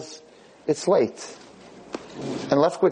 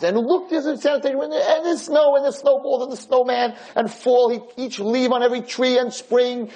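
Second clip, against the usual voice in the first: excited describing; 9 semitones higher than usual. Retold or imitated. Blind friend voiced describing the seasons.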